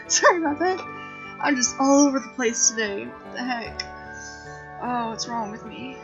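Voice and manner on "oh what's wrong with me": normal talking voice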